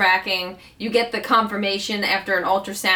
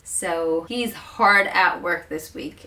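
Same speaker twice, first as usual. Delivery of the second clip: ordinary talk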